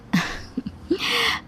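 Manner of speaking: short, high breathless laugh; covering giggle. Acted. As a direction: laugh it off after a slip. Bride covers up her slip.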